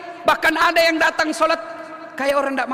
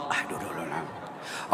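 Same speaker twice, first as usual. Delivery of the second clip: much lower, soft strained aduh with a breathy laugh; mock groan; 13 dB quieter than usual. Preacher imitates a youth failing to start prayer. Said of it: "dia langsung tak".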